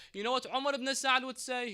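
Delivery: ordinary talk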